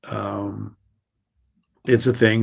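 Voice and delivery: ordinary talk, nothing marked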